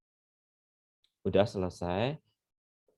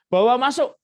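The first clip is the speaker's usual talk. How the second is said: loud high order; firm command; 11 semitones higher, 9 dB louder than usual. Retold or imitated. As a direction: bark it out as a decision. Pastor ordering her brought in.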